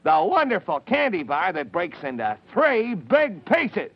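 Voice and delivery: upbeat announcer pitch, slow; ad read. Acted. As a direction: sell the candy bar. Reads candy ad.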